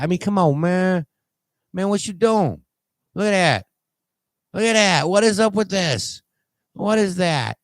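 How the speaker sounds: can't believe what he's seeing; incredulous exclaiming. Real, not acted. Reacting to odd surgery photos.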